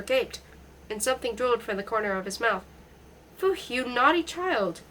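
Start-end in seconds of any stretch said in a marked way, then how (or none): 3.4-4.8 lightly voiced German accent, slow and chiding; a hint of German as she scolds slowly; mild German-accented mother chiding her daughter; slight German accent. A narrator's reading. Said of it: "with a slight German accent".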